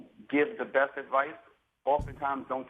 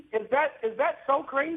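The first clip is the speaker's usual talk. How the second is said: incredulous; 13 semitones higher than usual. Real, not acted. Exasperated defending himself.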